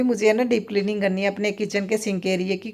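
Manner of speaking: ordinary talk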